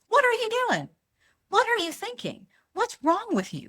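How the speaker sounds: high-pitched, indignant outburst; accusatory yelling. Acted. Confronting someone at the gas station, acted high accusatory reproach. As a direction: put them on the defensive, voice shooting up. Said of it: "I'm not gonna yell at him".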